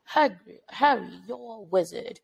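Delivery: imitated Hagrid in a British accent. Son quoting Harry Potter to mom, British accent. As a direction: put on a British accent, as a child mimics Hagrid. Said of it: "he said it with a British accent".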